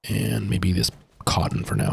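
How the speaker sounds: ordinary delivery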